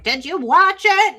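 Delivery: lightly mocking excited friend; imitating excited friends. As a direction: ape a friend's eager ask.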